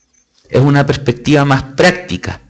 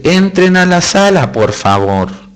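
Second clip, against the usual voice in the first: friendly professor voice; 4 semitones higher than usual. Acted. Professor calling his students in like good friends, put-on friendly voice. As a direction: call students in with a smile, as an imagined professor. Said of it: "me imagino que él habla así, con esa voz".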